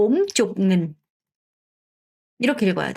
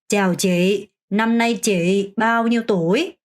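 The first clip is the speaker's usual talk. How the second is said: slow, clear Vietnamese question; slow model phrase; much slower than usual. Shown as a demonstration. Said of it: "문장 끝". (천천히 또박또박 시범 질문)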